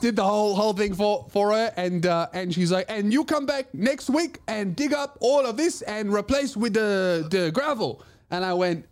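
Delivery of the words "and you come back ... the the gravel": put-on high-pitched Chinese lady's accent; high Chinese-accented imitation. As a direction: mimic a little Chinese lady's accent, bossing someone around. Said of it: "do the accent for that story".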